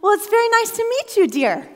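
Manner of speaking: high, loud, quick grandma greeting; acted grandmotherly pleasantry; imitated grandmother. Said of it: "she looks at Holly and says".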